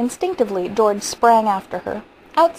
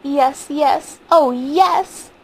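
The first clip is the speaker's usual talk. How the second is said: high, mounting, desperate agreement; frantic assent; 6 semitones higher, 6 dB louder, much slower than usual. A narrator's reading. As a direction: build to a loud, pleading final yes. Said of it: "a frantic, watery-eyed nodding".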